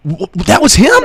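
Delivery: stammered, high disbelieving question; astonished stranger, imitated. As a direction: blurt it as the stunned bystander. Stranger learns who he sat with.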